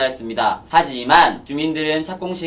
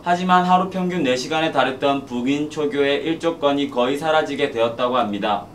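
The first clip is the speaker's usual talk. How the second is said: normal talking voice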